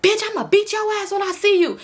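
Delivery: high, hard threat out the window; mock menacing high shout; threatening yell. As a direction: bark the threat at her. Amber threatens girl with gun.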